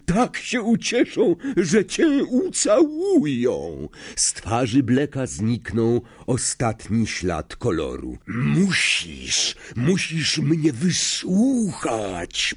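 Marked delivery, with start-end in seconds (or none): 0.0-3.9 high, drawn-out mocking relish; gloating taunt. A narrator's reading. 8.3-12.5 hoarse, slow, drawn-out plea. A narrator's reading. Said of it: "powiedział ochrypłym głosem".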